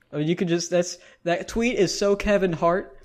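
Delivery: normal talking voice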